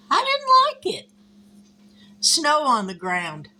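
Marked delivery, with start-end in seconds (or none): 0.1-1.1 sudden high squeak of displeasure; high, mock-whiny complaint; squeaky dislike. Real, not acted. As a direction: squeal it like you hate the sight. Reacting to snow outside.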